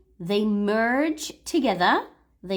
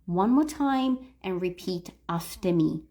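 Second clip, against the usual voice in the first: usual speaking voice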